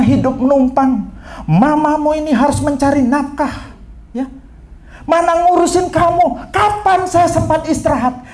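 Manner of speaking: high-pitched, overwrought mother; imitated exasperated lament; tearful scolding. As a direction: rail at the child, then cry out to God. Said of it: "Dia sambil nangis, dia bilang begini".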